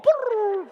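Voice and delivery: very high, loud rolling brrr; high loud trill of something rolling fast; tumbling brrr. Retold or imitated. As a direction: sound like a meatball bouncing downhill. Imitating tumbling down the slope.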